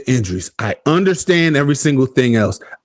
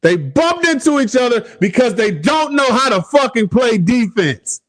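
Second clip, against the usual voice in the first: 1 exasperated rant; 11 semitones higher than usual. Real, not acted. Ranting about bad defense.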